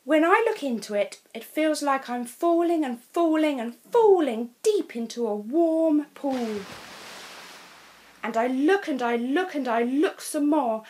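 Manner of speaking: teacher praising Molly's crystal before the class; Miss Plumbury. A narrator's reading. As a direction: praise it warmly and high.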